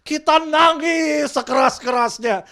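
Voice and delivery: mock shouted command. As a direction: bellow the absurd command slowly. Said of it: "Nggak begitu".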